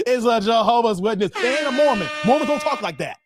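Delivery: normal talking voice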